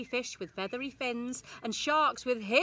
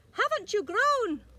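high flock greeting; 11 semitones higher, 6 dB louder than usual. A narrator's reading. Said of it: "who said".

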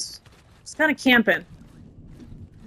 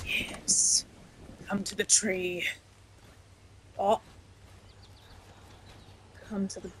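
soft hushed whisper; 3 semitones lower, 15 dB quieter than usual. Real, not acted. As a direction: breathe the words, barely voiced. Sneaking around in the game.

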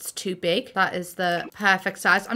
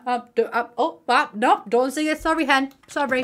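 usual speaking voice

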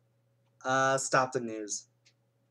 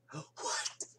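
shocked whisper; 10 dB quieter than usual. Real, not acted. Stunned the phone is calling.

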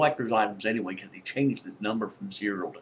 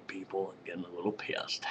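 softer, whispered grumble; annoyed whisper; 4 semitones lower, 11 dB quieter than usual. Real, not acted. Vlogger at three in the morning, whispered annoyance. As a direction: hiss it under your breath.

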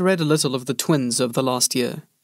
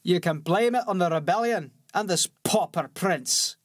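higher, softer warrior voice, scoffing; scornful soldier; 4 semitones higher, 4 dB quieter than usual. A narrator's reading. Bellis mocking the pauper prince, scornful soldier voice. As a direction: sneer at the rebels.

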